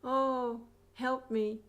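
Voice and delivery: high, loud plea; cry for help. Acted. Cruel person in pain calls out for help, high and loud. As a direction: call out helplessly. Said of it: "then they cry out".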